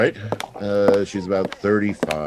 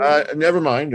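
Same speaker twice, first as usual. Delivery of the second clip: loud cover; 11 semitones higher, 6 dB louder than usual. Acted. Playing along with cover.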